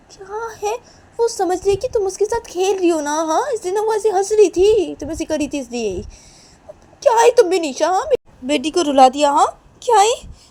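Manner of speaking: high, loud, heated telling-off; worked-up quarrelling voice; angry reproach. Acted. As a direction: demand the baby back indignantly. Acted blaming in the quarrel.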